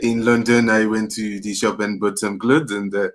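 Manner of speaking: mock French accent with hesitant uhs; low-key put-on French accent. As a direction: imitate a French person groping for English words. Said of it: "let me do French".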